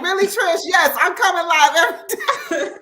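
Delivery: high, laughing retort; laughing reply. Retold or imitated. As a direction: answer with a teasing laugh.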